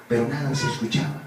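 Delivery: ordinary talk, nothing marked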